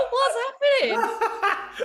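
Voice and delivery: very high, very slow squeal through laughter; laughing disbelief. Retold or imitated. Retelling the shocking stage moment to the host, squealing with laughter. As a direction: squeak it out mid-laugh, totally baffled. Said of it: "We're like".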